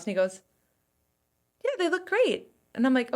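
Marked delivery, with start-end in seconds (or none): 1.6-2.5 high-pitched imitation of husband's approval; reassuring husband. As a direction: lift the pitch into unconvincing praise. Voices husband's reassuring verdict.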